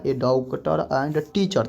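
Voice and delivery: very slow, word by word; drawn-out board example; slow dictation. Shown as a demonstration. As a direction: say it slowly for the class. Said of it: "कथी बन जाएगा".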